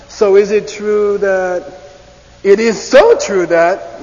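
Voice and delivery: slow, loud, drawn-out so is it, then it is so; slow contrast of tells. Shown as a demonstration. Teacher gives both English tells slowly and loudly. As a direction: stretch each phrase to show the two tells. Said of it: "Hear it".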